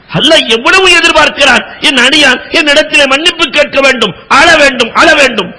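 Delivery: high, lifted preacher's voice; impassioned preaching. Acted. Preacher tells the congregation of the singer's tears, impassioned. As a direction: preach it with rising fervour.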